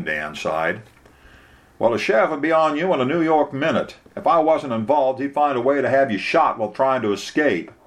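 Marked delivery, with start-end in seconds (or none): 1.8-7.7 higher-pitched lawyer giving a warning; Bubba, warning. Acted. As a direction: caution the client as a blunt local lawyer. Voices Bubba warning Dan.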